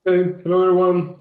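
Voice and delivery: ordinary talk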